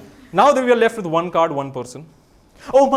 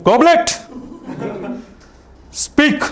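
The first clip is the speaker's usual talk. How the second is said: mock command; 10 semitones higher, much slower than usual. Mentalist on stage ordering the goblet to speak. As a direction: bark at the goblet like a showman.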